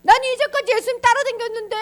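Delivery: high, loud, whining protest; hammed-up distraught wailing questions; panicked Peter. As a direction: complain in acted dismay, demanding answers. Peter panics about losing his place.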